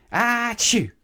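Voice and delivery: a very high, loud sneeze. Acted. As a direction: let the sneeze explode. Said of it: "he warned".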